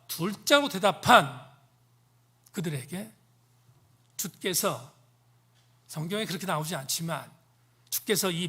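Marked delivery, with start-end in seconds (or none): none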